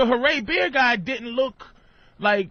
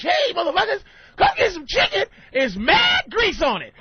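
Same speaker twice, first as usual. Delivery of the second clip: high loud hammy commercial; loud ad pitchman; 8 semitones higher, 3 dB louder than usual. Retold or imitated. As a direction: shout it like a cheesy ad. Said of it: "I bet you if you do watch".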